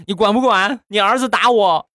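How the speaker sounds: high-pitched aggrieved complaint; 委屈告状. Acted. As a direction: wail it as an indignant tattletale. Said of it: "出来之后我很委屈". (委屈地高声告状)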